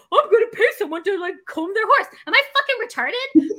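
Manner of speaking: high, disbelieving rant; incredulous self-mockery. Paying to comb horses, high incredulous scoff. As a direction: scoff at your own idea.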